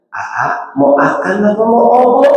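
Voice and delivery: put-on cooing wife, babyish dropped consonants; spoiled baby-talk voice, akan and obo; cadel baby talk. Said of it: "dicadel-cadelin".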